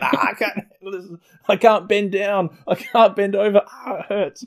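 high laughing cries of pain; pained whine. Retold or imitated. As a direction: whine it like it hurts. Voicing men in pain.

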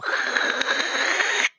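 high squeaky peeling sound; a squeaky rip noise. Retold or imitated. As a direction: mimic cellophane peeling off. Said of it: "and it goes".

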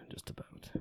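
soft unvoiced whisper of the tag line; whispered aside. Real, not acted. Host whispering a joke about Chad's beers, hushed. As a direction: breathe it out as a sly afterthought.

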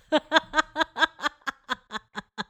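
embarrassed laugh at herself. Real, not acted. To viewers about being blocked, rueful laugh. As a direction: laugh it off, high.

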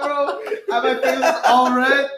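usual speaking voice

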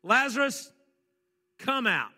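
called out loud and high, like a command to a tomb. Acted. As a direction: call Lazarus out of the grave in full voice. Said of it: "He called in a voice so loud that everyone around could hear".

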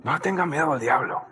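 murmured echo of the shout; quiet repeat. Acted. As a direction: drop it to a quick hushed aside. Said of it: "repeats line, second time quietly".

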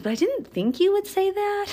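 imitating the lady. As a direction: squeak it up high, half surprised. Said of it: "And she goes".

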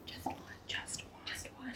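hushed, voiceless whisper. Pretend. Host stressing you need one other person, whispered. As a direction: whisper it over like an echo.

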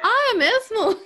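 high, loud surprised gasp of a question; genuinely taken aback, voice jumping up; real surprise. Real, not acted. Surprised at her height.

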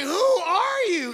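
loud, slow, high "who are you"; a dad stunned by his own kid; hammed-up disbelief; mock shock. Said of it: "I'd be like".

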